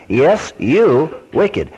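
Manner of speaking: slow, deliberate, stress on you; preacher answering the sinner, slow; firm reply. Acted. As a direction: lay it on him word by word.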